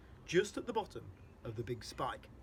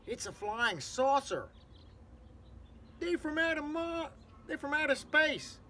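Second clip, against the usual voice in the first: high, slow, thrilled gasping crowd; breathless, high-pitched amazement; wild excitement; 12 semitones higher, 3 dB louder, slower than usual. Acted. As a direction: cry it out as if seeing a miracle. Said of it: "everyone went wild with excitement".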